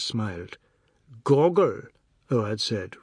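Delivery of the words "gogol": Gogol said loud, falling, chin raised; lofty pronunciation. A narrator's reading. Oad showing off to the family, Gogol said proudly. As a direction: declaim the name Gogol proudly. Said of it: "raising his chin".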